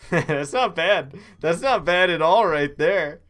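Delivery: delighted. Real, not acted. Pleased with thief costume.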